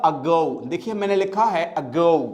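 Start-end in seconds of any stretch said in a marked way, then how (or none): 0.0-0.7 "Ago" with short "uh", weight on "go"; correct pronunciation. Shown as a demonstration. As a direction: say "Ago" low as "uh-GO". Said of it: "तो ये क्या हो जाएगा?". ("Ago" को "अ-गो", "गो" पर ज़ोर)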